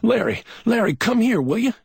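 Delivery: high, loud urgent call across the hall; shouting. A narrator's reading. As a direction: call him over in alarm, loud and high. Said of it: "he was shouting".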